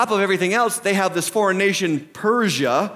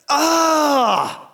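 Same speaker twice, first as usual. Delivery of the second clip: high, long exasperated "ugh"; disgusted groan; 7 semitones higher than usual. Acted. Preacher reacting to the news, groaning in dismay. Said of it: "That's just".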